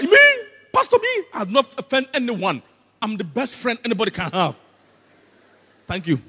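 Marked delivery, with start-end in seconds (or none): 0.0-4.6 high, incredulous mock protest; mock indignant protest. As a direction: protest your innocence, high and loud, then settle. Acts an offended, boastful congregant.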